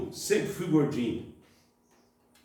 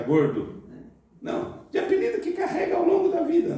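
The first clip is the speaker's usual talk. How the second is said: ordinary talk, nothing marked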